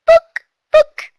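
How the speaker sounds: very high, tiny squeaky mouse voice; little mouse voice. Shown as a demonstration. A teacher squeaking a word as a mouse for young learners. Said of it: "mouse voice".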